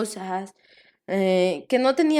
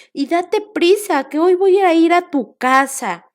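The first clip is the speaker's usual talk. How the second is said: loud, higher call to hurry; shouting; 7 semitones higher, 8 dB louder than usual. Acted. Jesus calling Zaqueo to hurry down, loud and high. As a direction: urge him down with a loud call. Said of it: "le gritó y le dijo".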